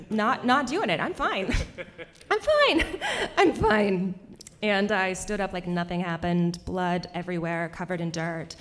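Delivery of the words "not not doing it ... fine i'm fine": high, laughing mock denial; insisting she's fine. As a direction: squeak out a hammed-up "fine", higher each time. Denying the injury after falling.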